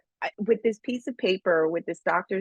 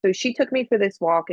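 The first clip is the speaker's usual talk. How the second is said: normal talking voice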